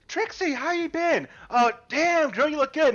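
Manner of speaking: happy to see her back; friendly greeting. Acted. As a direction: greet her like a long-lost regular. Old acquaintances greet Trixie.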